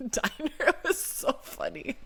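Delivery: real helpless laughter, words nearly lost in it; laughing. Real, not acted. Recalling the weird diner night, laughing. Cracking up over a diner story.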